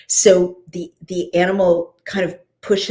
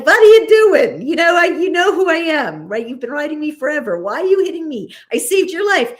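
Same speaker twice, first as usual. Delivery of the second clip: high, exasperated scolding in a donkey's role; hammed-up indignant protest to the rider; annoyed donkey; 9 semitones higher, 5 dB louder, faster than usual. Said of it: "she's annoyed with him".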